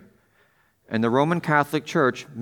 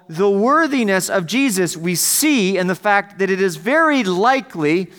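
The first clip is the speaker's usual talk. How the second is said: higher-pitched, insistent pulpit delivery; emphatic preaching; 7 semitones higher, 4 dB louder than usual. Real, not acted. Pastor preaching the worthiness of Jesus to the congregation, emphatic. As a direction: preach it with energy, leaning on key words.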